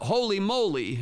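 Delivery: high, loud call-out; teacher's yell. Shown as a demonstration. Teacher calling for kids' attention, high yell. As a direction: yell it as a classroom cue. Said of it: "the teacher can yell out".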